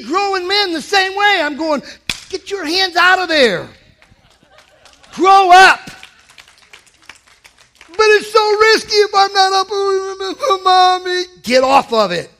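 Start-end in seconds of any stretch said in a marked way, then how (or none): 2.3-5.8 louder and higher stern rebuke; mock stern shout, much louder; scolding yell. Scolding grown men. 7.9-11.2 put-on higher, slow, loud childish whine; hammed-up big baby pleading; whiny child. Whiny grown child answering.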